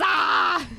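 high, loud comic yell; hammed-up high "ah"; loud meme scream. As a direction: belt out a mock scream. Yells the meme's punchline.

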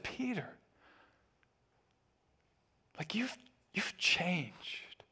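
a soft, breathy hush to Peter. Pretend. Church members marveling quietly to Peter, whispered. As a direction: whisper it like a gentle secret.

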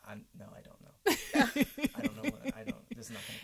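whisper. Retold or imitated. Host whispering a mock flustered designer. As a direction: whisper it like she's lost.

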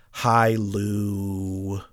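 Lou drawn out long at the end; mock coworker greeting, Lou held long; imitating stretched name. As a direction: stretch the last syllable of Lou. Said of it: "he would extend the last syllable of it".

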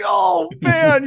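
high, sudden oh man of pity; high sympathetic groan of dismay. Retold or imitated. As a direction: wince for him out loud. Reacting to heartbroken teen.

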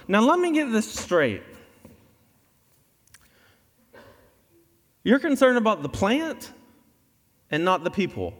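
high, disbelieving challenge; incredulous God. Retold or imitated. God confronting Jonah over the plant, high incredulous. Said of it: "God's going".

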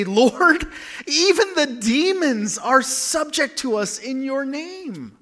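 high, loud, thrilled disciples; excited report. Acted. Disciples telling Jesus, excited and loud. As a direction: burst in with amazed joy.

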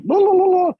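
high, loud mock-Arabic babble; mock Arabic gibberish. Two-pip officer ranting in Arabic, high loud gibberish. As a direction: rattle off nonsense like an angry officer. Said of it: "and he's like".

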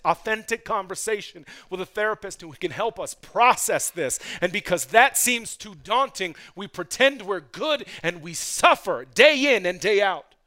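loud, high impassioned preaching; rising sermon climax; full-force preaching. Acted. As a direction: build it up and preach hard to the room.

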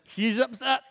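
loud, high mock alarm; tense reaction. Guys watching Martha get upset, loudly alarmed. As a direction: play the guys bracing for trouble. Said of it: "Everybody's feeling the tension".

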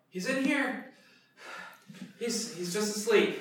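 high, loud call of relief. Acted. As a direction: shout the news back to the others. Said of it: "you hear her call out".

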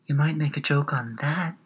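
small voice right at the ear; little voice. A narrator's reading. Gnat prompting Alice to joke, little voice. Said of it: "said the little voice close to her ear".

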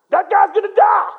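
high, loud blurted spoiler; retold yell. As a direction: shout it out like a rowdy teen at the movies. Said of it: "Yells it out".

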